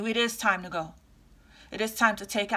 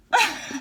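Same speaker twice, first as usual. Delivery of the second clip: high burst of laughter; short, high self-amused laugh; 9 semitones higher, 5 dB louder than usual. Real, not acted. Reader admits she would do that too, laughing. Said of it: "I'm laughing because".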